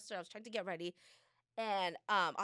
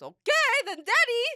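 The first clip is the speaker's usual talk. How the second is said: put-on much higher, louder child voice; mock eager kid; 13 semitones higher, 10 dB louder than usual. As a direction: bounce it like an excited little boy.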